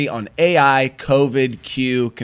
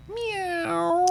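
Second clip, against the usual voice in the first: cat meow; 8 dB quieter than usual. Pretend. Cat joke after little cat.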